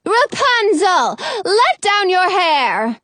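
slow, high call up to a window; drawn-out witch's summons; calling from outside. A narrator's reading. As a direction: call up from below, drawing out each word. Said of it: "a woman's voice from outside".

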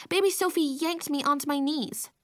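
ordinary delivery, nothing marked

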